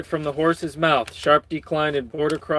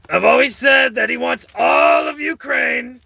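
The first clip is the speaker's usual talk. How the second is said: Trump impression; 7 semitones higher than usual. Vlogger showing off his Trump impression, shouting hoarsely. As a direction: bellow it like Trump with a sore throat. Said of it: "That's my Trump, uh, impersonation".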